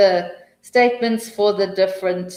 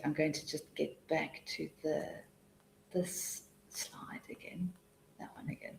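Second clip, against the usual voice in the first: unvoiced muttered aside to herself; whispered aside; 18 dB quieter than usual. Real, not acted. Teacher sorting slides mid-class, whispered aside to herself. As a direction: whisper it to yourself while fiddling with slides.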